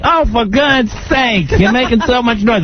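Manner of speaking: high, slow mock-exasperated mother; exasperated mom. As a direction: drag out a high complaint at the noise. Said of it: "My mother's like".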